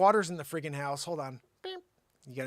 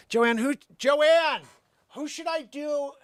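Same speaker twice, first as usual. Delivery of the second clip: raised, higher voice calling someone over; louder, drawn-out summons; 7 semitones higher, 3 dB louder, slower than usual. Real, not acted. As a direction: call her name loudly to get her attention. Calling to Joanne off mic.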